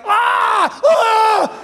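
high shrieking cries of a possessed man; demon shrieks. Acted. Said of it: "And then there's a demon guy".

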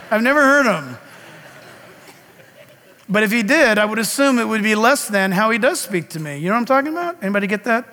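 normal talking voice

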